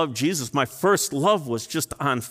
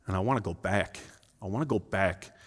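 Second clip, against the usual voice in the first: much softer and lower, near tears; quiet longing; 7 semitones lower, 7 dB quieter than usual. Real, not acted. Pastor confessing to the church his longing to return, hushed. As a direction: confess it softly, aching to return. Confessing repentance before congregation.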